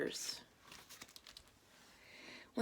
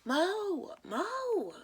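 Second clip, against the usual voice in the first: much higher calling out; shouting name; 14 semitones higher than usual. A narrator's reading. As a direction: yell to get his attention. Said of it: "Coach Marie shouts".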